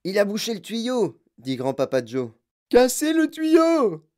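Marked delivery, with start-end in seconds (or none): 0.0-1.2 higher-pitched cry of discovery; excited exclamation. A narrator's reading. Grandpa Joe sees the pipe is blocked, excited. 2.7-4.0 high, loud, drawn-out scream; howling. A narrator's reading. Mother demands the pipe be broken, howling. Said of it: "hurla Madame Gloop".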